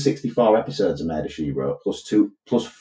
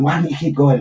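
mock moaning wife; 7 semitones higher than usual. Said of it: "she's moaning at her husband".

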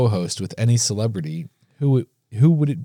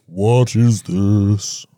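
basso profundo; much slower than usual. Shown as a demonstration. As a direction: drop into the deepest bass you have. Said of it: "Basso profundo".

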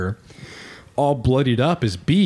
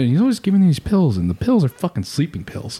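normal talking voice

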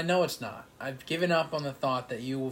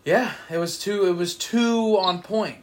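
exasperated; 5 semitones higher, 8 dB louder than usual. Real, not acted. Bemoaning close miss.

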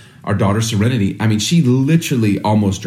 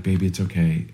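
soothing; 5 semitones lower, 7 dB quieter than usual. Retold or imitated. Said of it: "you're like".

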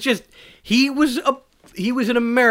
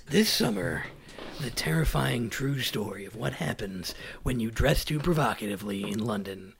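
movie trailer voice; 6 semitones lower, 7 dB quieter than usual. Pretend. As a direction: announce it like a horror film trailer.

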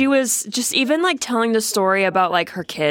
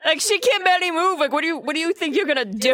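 incredulous; 8 semitones higher than usual. Real, not acted. Incredulous about the scene.

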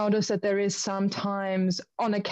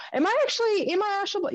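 much higher, dazed doubting voice; mock confusion; 12 semitones higher than usual. Traveler mocking his own confusion after astral travel, high dazed. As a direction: play it lost and disoriented. Retold confused self-question.

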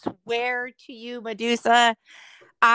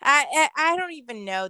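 normal talking voice

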